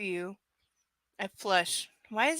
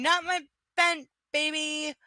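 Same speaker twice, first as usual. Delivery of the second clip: imitating Mark; 6 semitones higher than usual. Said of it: "rather than just saying".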